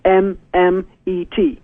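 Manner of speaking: slow, letter by letter spelling; each letter spaced out clearly. Shown as a demonstration. Spelling his own name so listeners can find the site. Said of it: "spelling out the name".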